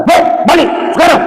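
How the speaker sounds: re-enacted high-pitched snap; snapping at a pupil. Teacher sending a pupil home, acted high snap. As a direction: snap an order, voice jumping high. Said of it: "Saya senggak".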